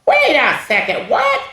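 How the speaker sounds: mock disbelief leaping up high; acted bafflement. Reader baffled by the book's nonsense, comic disbelief. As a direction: shoot up high on the last word.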